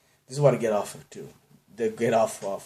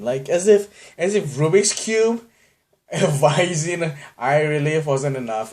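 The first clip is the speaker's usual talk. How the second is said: mock indignant; 4 semitones higher, 5 dB louder, slower than usual. Real, not acted. Mocking own nerdiness.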